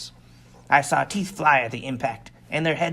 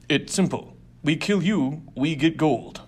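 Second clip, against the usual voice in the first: brutish gang boss. Acted. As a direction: bark it like a greedy brute. Diumph threatens.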